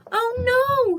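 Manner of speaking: high, loud dismayed hoot; loud high wail of dismay. Acted. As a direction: hoot it out in alarm. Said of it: "hooted Percy".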